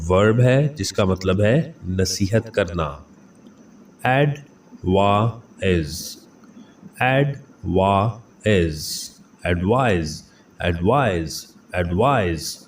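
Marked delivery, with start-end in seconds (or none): 4.1-9.1 advertising stretched out very slowly; teacher slowly modelling the word for students; slow syllable by syllable. Shown as a demonstration. Slow, careful pronunciation drill. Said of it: "आप difference check कर सकते हैं".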